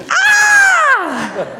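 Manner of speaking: high, loud mock scream of excitement; coming-in-hot scream. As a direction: burst in with an over-excited, high-pitched "Ah!". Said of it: "I call it coming in hot".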